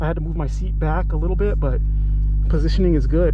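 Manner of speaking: talking through a closed helmet; muffled, mouth behind a helmet visor. Real, not acted. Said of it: "especially since I'm speaking with a helmet".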